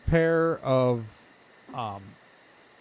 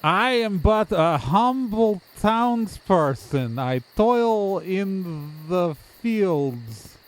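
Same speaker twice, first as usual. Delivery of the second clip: slow, drawn-out, stilted declaration; humble townsperson act; 2 semitones higher, slower than usual. Acted. Townsperson telling visitors what he does, theatrically humble. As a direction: stretch it out with mock humility.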